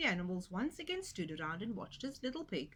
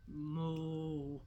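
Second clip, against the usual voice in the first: lowing cow; 4 semitones lower than usual. Acted. Cow lows.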